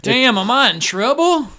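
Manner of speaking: put-on loud, high drunken alarm; Crazy Eddie. Drunk thinks a crowd came for him, loud alarmed voice. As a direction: blurt it out like a worried drunk. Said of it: "Crazy Eddie is the town drunk".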